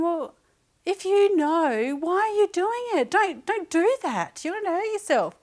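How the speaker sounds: high, loud pleading, slowing on the key words; mother begging her son to stop, high and loud; alarmed pleading. Retold or imitated.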